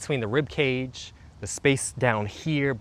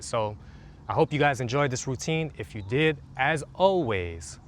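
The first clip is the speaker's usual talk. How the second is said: ordinary talk, nothing marked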